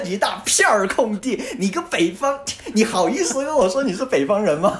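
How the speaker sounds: teasing through laughter, high; laughing. Real, not acted. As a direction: laugh while mocking his accent. Laughing teasing about his accent.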